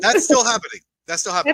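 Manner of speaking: loud, high insistence; loud insisting. Real, not acted. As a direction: insist loudly.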